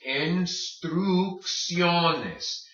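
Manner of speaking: instrucciones slowly, een-strook-SYOH-nes; careful instrucciones, K sound then S; hard then soft C. Shown as a demonstration. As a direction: stretch each syllable of instrucciones. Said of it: "First C hard".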